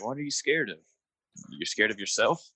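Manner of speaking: ordinary talk, nothing marked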